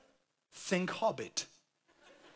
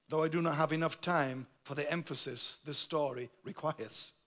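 ordinary talk, nothing marked

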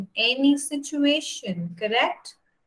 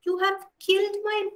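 hysterical scream; 7 semitones higher than usual. Acted. Wife accuses the mongoose of killing her baby, a mild hysterical cry rising high. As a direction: accuse the pet in a frantic rush. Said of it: "She screamed hysterically".

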